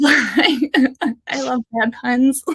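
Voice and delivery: giggling through the words, slow; laughing. Real, not acted. Host laughing at her own bad rabbit pun, giggly. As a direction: laugh it out, amused at yourself.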